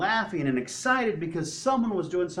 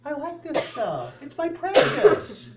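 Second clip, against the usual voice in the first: high voice, swelling loud and slow on "precious"; cling to it like a hoarded treasure; possessive clinging; 9 semitones higher, 7 dB louder than usual. Acted. Believer refusing to give up stress, hammed-up possessiveness.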